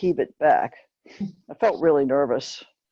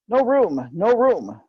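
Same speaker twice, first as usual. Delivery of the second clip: raised, higher calls of no room; passengers refusing entry; 6 semitones higher, slower than usual. Retold or imitated. Crammed passengers shout through the window to the girl, raised and higher. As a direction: turn her away from a packed train.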